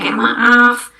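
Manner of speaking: ordinary talk, nothing marked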